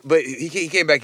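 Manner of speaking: ordinary talk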